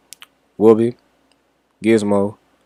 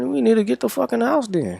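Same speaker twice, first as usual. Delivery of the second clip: nervous urgency; 14 semitones higher, 4 dB quieter than usual. Retold or imitated. Wants to flee before shooting.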